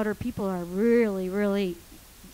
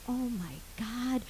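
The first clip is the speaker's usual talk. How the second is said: soft, slow gasp of hope; hushed disbelief; 11 dB quieter, slower than usual. Retold or imitated. Trapped woman hearing steps on the stairs, hushed. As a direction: breathe it out softly before calling. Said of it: "And I'm like".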